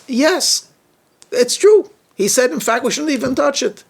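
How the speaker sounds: high, eager voice of Chava; Chava's eager reply. Acted. Chava answering the serpent, eager and high. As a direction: answer the serpent, keen and sure.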